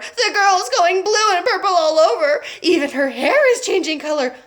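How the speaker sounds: high, loud alarm; a mother crying over her daughter; frantic mother. A narrator's reading.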